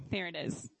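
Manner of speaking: usual speaking voice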